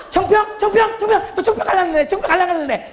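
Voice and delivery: high-pitched eager repeated questions; excited sociable type. Acted. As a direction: ask around in an acted flutter. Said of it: "I 유형은 어떠냐면". (들뜬 사교형이 묻고 다니는 흉내)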